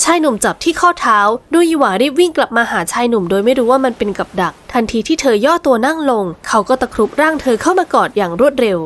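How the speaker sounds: ordinary delivery, nothing marked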